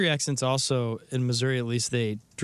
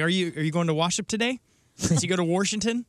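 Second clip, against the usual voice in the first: higher retold question; retold child's question; 6 semitones higher, faster than usual. Retelling a child asking mom about church, higher questioning. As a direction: ask it pitched up, R added in "Washington". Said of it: "Yeah, I was like".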